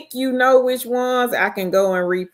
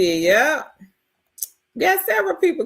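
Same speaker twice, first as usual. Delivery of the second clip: normal talking voice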